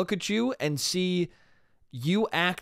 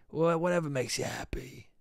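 softer, gentle dad giving in; gently; 5 dB quieter than usual. Retold or imitated. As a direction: ease off and let it go kindly. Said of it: "he very gently was like".

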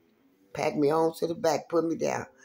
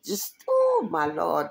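pained cry; slower than usual. Retold or imitated. Recalling the leg pain.

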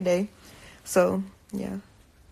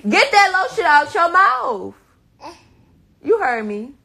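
much higher, loud scolding; sharp scold; 8 semitones higher, 5 dB louder than usual. Real, not acted. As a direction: raise the pitch, scold hard. Scolding child with lotion.

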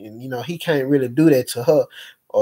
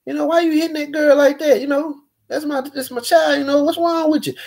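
retold angry confrontation; 11 semitones higher than usual. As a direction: replay yelling at her, voice high and indignant, volume held back. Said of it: "I got mad as hell".

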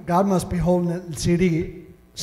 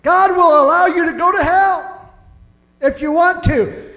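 higher, louder proclamation of God; loud preaching; 9 semitones higher, 5 dB louder than usual. Acted. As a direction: thunder the warning from the pulpit. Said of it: "repeated louder".

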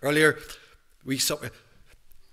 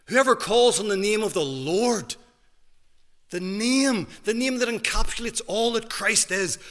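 louder, higher scripture proclamation; preaching at full force; 7 semitones higher, 8 dB louder, slower than usual. Acted. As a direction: proclaim the verse to the hall.

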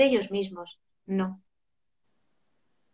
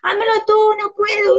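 put-on high, loud childish wail; crying child; 9 semitones higher, 8 dB louder than usual. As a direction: whimper that you can't. (niño llorando que no puede)

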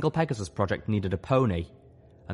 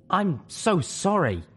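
earnest apology; 10 semitones higher, slower than usual. Acted. Spike apologising to Little Pip.

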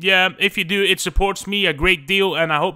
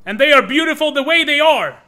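mock indignant defense; 9 semitones higher, 8 dB louder than usual.

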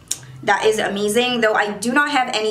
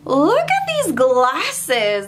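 gushing; 4 semitones higher, much slower than usual. Real, not acted. Zooming in on herself.

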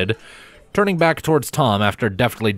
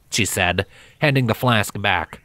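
usual speaking voice

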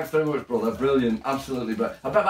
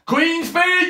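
high-pitched, eager call to the room; excited rallying cry; 11 semitones higher, 4 dB louder than usual. Acted. Calling friends to the Queen's Christmas broadcast, excited cry. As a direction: announce it like a big event starting.

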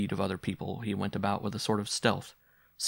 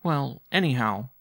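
put-on slow, higher boy's declaration; stout; 4 semitones higher, 4 dB louder, slower than usual. A narrator's reading. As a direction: declare it stoutly as a small boy. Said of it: "he said stoutly".

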